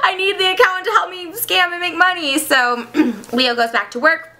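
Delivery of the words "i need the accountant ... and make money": frantic, rising plea; panicked plea. Acted. Acting out Leo's boss-to-be needing the accountant, panicked. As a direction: plead in a mock panic. Acts out panicked character in plot.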